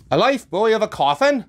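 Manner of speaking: loud, high, shocked question; startled cry. Acted. Starbuck hearing the coffin idea, loud startled cry. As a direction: cry it out in disbelief. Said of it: "cried Starbuck".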